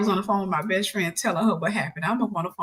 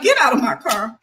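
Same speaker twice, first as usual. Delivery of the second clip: raised, louder amused order; laughing dismissal; 4 semitones higher, 6 dB louder than usual. Retold or imitated. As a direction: re-enact throwing someone out while amused. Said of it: "I was just laughing".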